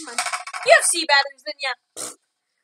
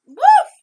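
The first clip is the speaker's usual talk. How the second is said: dog bark; 12 semitones higher, 10 dB louder than usual. Pretend. Barking at robot dog.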